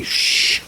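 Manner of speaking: unvoiced "shh"; shushing. Unknown somebody heard after the crash, shushing. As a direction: hush someone. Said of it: "we hear somebody say".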